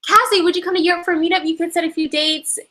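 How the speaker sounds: ordinary talk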